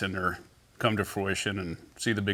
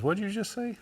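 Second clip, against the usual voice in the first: retold surprise; 13 semitones higher than usual. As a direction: imitate a friend caught off guard. Retold reaction to a teasing question.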